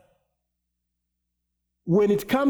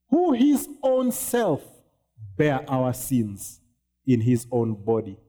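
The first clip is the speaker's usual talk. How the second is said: scripture reading; 5 semitones lower than usual. Acted. Reads First Peter aloud.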